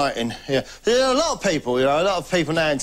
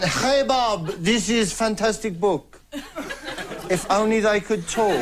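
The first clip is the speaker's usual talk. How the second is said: put-on Spanish accent; 4 semitones higher, slower than usual. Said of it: "here's the other one".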